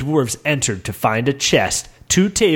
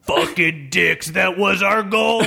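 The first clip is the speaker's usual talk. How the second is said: angry shout; 4 semitones higher than usual. Acted. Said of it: "shouted Bolindor".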